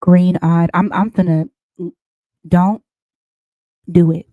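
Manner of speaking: loud warning. Acted. Reader warns the viewer about jealousy, loud and low. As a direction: shout the warning across the room.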